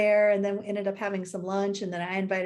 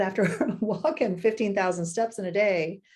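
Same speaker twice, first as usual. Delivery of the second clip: ordinary delivery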